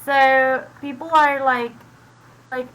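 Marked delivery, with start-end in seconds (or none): none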